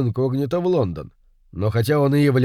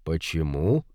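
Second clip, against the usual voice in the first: low, softer musing question; thoughtful murmur; 8 semitones lower, 6 dB quieter, slower than usual. A narrator's reading. Poirot repeats the question to himself, thoughtful murmur. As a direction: murmur it to yourself, pondering. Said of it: "задумчиво пробормотал он".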